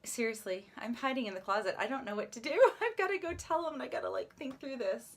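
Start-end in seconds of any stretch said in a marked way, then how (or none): 2.5-4.1 nervous laughing. Real, not acted. Hiding in closet, nervous laugh.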